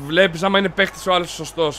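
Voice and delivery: normal talking voice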